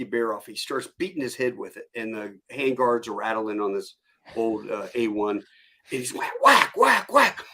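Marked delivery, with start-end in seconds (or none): 6.1-7.4 loud, high, punchy repeated whacks; imitated whacks. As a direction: act out each blow with your voice. Imitates rifle-range beating with hat.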